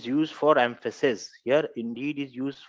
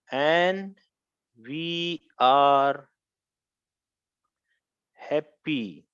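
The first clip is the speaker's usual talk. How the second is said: drawn-out words with long pauses; slow dictation; much slower than usual. Shown as a demonstration. Teacher giving the class an example, slow dictation. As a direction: dictate it word by word.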